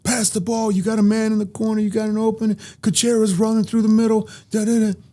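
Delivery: high, hammed-up coach yell; mock coach shouting across the court. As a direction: bark orders at a player like a coach from the sideline. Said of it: "I'd hear him yelling".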